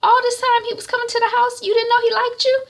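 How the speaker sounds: high, loud and fast disbelief; can't believe her sister missed it; shocked disbelief. Retold or imitated. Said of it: "Shaliwa's like".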